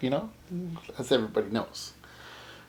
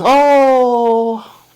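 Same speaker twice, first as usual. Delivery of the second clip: put-on quoting the old man, apologetic; 7 semitones higher, 6 dB louder, much slower than usual. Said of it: "he says".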